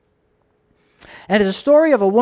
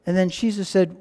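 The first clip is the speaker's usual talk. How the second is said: ordinary delivery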